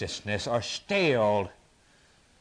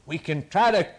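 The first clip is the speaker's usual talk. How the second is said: full-force preaching; 9 semitones higher than usual. Acted. Preacher proclaiming God's glory to the congregation, full force. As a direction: preach it to the rafters.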